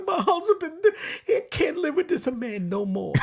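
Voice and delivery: high-voiced comic Italian old lady; old Italian woman. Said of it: "she brings the old Italian woman in".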